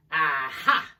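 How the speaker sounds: loud gleeful Aha!; triumphant aha. A narrator's reading. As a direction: crow it in triumph. Friend triumphant about the card.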